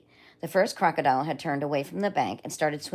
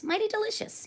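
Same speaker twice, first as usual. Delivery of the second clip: high, savouring mighty delicious; crocodile voice; 4 dB quieter than usual. Acted. Crocodile tasting the lollipop, high and savouring. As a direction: smack the lips like a pleased crocodile. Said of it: "he said".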